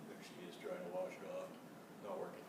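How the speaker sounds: soft, low commentary; hushed aside. Real, not acted. Reader quietly pointing at the picture for the children. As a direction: murmur at the picture.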